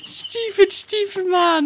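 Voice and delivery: high, helpless laughter; can't stop laughing at how dumb it is. Real, not acted. Host mocking the judge, laughing hard.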